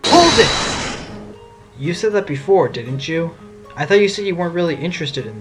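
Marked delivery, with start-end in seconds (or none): 0.1-1.1 high, forceful courtroom shout; shouted interruption. Acted. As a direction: cut in with a sudden loud yell. Attorney interrupts testimony with objection.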